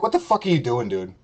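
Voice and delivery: ordinary talk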